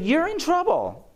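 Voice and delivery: high, loud retold pronouncement; pointed warning, acted out; emphatic warning. Retold pronouncement that startles his patient.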